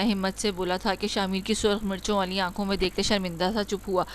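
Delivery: ordinary delivery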